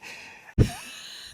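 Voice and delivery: airy wheezing laugh; high breathless laugh. Real, not acted. Vlogger laughs breathlessly at the ambassador's answer. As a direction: laugh helplessly, almost silent.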